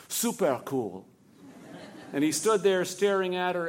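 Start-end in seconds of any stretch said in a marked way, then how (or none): 0.1-1.0 low, laid-back cool with a laugh; super cool. A narrator's reading. Sam remembers how the girl said it, low and cool. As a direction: say it like the coolest thing ever. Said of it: "as cool as the way she had said".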